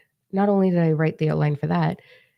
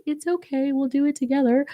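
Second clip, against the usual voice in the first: crazy loving; 10 semitones higher than usual. Pretend. As the loving planet comforting its people, tender high coo. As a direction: coo it like a doting caretaker. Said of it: "I'm actually, like, crazy loving too".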